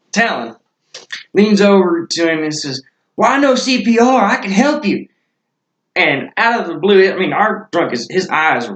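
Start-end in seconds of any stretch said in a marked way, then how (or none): 3.2-5.1 high, louder mimicked offer; imitated eager helper. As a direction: offer help in a raised, eager voice. Imitates Talon eagerly offering CPR.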